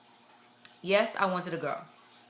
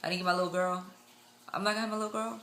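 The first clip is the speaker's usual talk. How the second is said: softer, mock-deflated voice; mild retold disappointment; 7 dB quieter, much faster than usual. Mother-to-be to herself on missing a girl, mildly disappointed. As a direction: say it small, quietly let down. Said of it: "I was kinda like".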